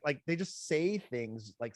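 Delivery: usual speaking voice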